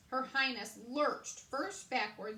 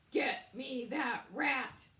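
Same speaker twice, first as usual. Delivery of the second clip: commanding; 3 semitones higher, 6 dB louder, much slower than usual. Acted. King orders guards.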